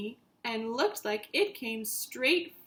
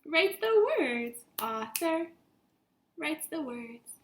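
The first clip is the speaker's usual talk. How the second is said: high teaching sing-song; 5 semitones higher than usual. Shown as a demonstration. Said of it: "What does the author do?".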